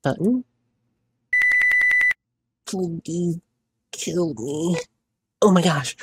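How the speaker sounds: a high, strained plea to be put out of pain, loud cries on the worst lines; anguished begging. Acted. Button, dying in pain, begs the player to kill her, anguished. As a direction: sob out the plea for death through the pain.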